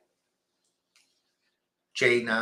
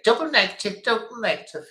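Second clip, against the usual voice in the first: pedantic correction; 10 semitones higher, 4 dB louder than usual. Retold or imitated. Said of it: "he'd immediately say".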